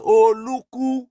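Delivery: high, drawn-out shout of Oh Oluku; shouted call. Shown as a demonstration. Calling out Oluku on the street, high shout. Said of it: "Now I shout".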